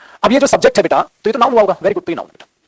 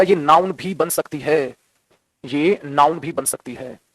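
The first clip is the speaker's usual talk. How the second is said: slow emphatic; 4 semitones lower, 5 dB quieter, much slower than usual. Shown as a demonstration. Teacher stressing point slowly.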